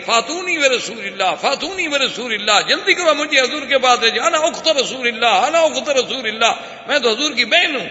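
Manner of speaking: a captive woman pleading high and urgent; insistent outcry. Acted. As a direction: demand to be taken to him. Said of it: "ایک عورت شور مچا رہی ہے".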